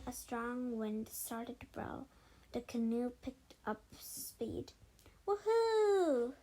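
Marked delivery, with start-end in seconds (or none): 5.3-6.3 high, loud whoop of delight; joyful whoop. A narrator's reading. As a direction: yell for the thrill of speed. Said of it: "George shouted".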